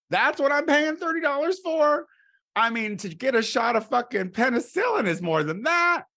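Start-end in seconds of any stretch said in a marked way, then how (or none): none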